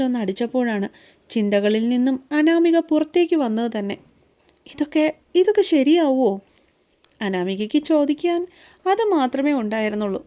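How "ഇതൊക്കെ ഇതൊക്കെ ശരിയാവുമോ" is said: hesitant, higher-pitched question; unsure, repeating the opening words; worried. A narrator's reading. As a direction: ask with mild apprehension. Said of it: "അനാമികയ്ക്ക് കൂടുതലും പേടിയായിരുന്നു".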